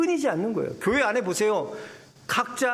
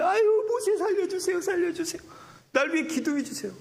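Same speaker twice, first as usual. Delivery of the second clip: high, laughing mock plea for help; hammed-up begging sister; pleading Miriam; 10 semitones higher than usual. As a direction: beg Moses in a high voice, half laughing. Stricken Miriam begs Moses.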